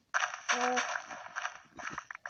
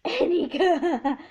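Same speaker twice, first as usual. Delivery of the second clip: laughing; 3 semitones higher, 12 dB louder, much slower than usual. Real, not acted. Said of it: "laughing repeat".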